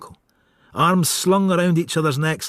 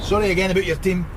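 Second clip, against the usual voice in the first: ordinary delivery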